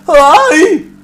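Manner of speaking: high, very loud garbled yell of the hook; imitated yelping shout; mangled singer impression. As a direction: blurt it out like a singer swallowing the consonants. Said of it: "but he used to go".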